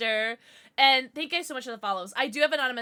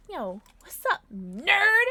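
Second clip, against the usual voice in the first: "nerd" jumps up high and loud; teasing hail; 5 semitones higher, 6 dB louder, slower than usual. Real, not acted. Streamer greeting a viewer as "nerd", playful loud tease. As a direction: punch "nerd" with a high rising lilt.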